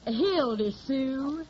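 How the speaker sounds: slow, drawn-out Southern name, rising; put-on hillbilly accent. Hildy tells the record man her name, in a slow Southern drawl. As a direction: drawl your name like a country girl. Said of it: "I got the technique down pat".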